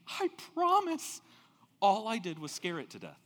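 imitating a son swearing he only scared it; protesting son. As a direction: insist on your innocence to your father. Son answering his dying father.

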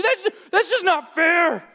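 high, loud, stretched-out whine; child fighting back. Acted. Disobedient child fighting back at parent, whiny protest. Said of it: "they fight back at you".

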